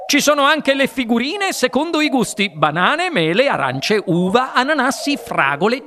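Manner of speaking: high, eager start settling into a sing-out list; eager inventor. A narrator's reading. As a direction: reel off the fruits with relish. Wonka lists flavours excitedly. (inventore entusiasta che elenca i gusti)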